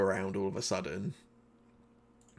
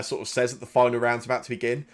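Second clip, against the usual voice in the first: usual speaking voice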